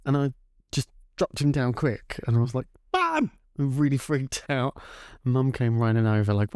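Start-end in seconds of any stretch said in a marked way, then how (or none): 2.9-3.3 high, frightened cry for Mum; a small child calling out scared; panicked child's call. Retold or imitated. Child panicking over frozen hamster.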